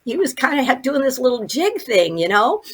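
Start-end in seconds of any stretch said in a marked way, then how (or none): none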